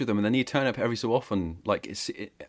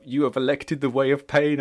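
strained, half-held-back shout; barely holding in a yell; 6 semitones higher, 7 dB louder than usual. Acted. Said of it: "I'm trying not to shout".

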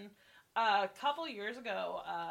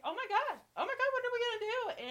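frantic, much higher and fast; panicked; 13 semitones higher, 3 dB louder, faster than usual. Retold or imitated. Young supervisor panicking over her assault, frantic high rushed voice. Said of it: "he was just so panicked".

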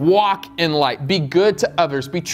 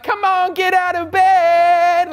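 high, slow, louder mock mother calling; mom waking me; 14 semitones higher, 4 dB louder, slower than usual. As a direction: call him out of bed like his mom, high and drawn out. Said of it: "and she would, like".

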